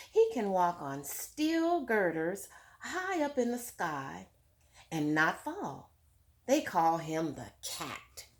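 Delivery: clear, fuller read-aloud voice to the class; story narration. A narrator's reading. Teacher reading Tar Beach to her class, lively read-aloud. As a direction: read it out like a picture book for children. Reads book text aloud.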